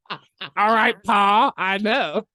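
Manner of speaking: high, loud mock country reply; lightly hammed-up son answering Pa; mock dutiful son. Mock reply to the Southern mentor.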